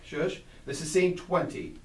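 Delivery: ordinary talk, nothing marked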